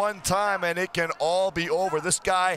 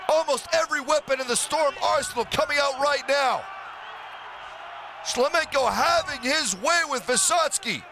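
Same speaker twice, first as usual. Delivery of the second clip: excited play-by-play; 4 semitones higher than usual. Real, not acted. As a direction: call the flurry like it's happening now. Commentator calls knockout flurry.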